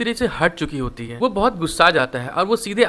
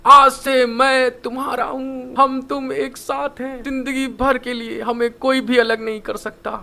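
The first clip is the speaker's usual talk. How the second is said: higher, louder and drawn out, bellowing a vow; loud slow shout; 5 semitones higher, slower than usual. Retold or imitated. Blackie vowing to Woojin, a loud slow shout. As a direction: shout each word slowly like a lovesick declaration. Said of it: "फिर बहुत तेज चिल्ला के बोलता है".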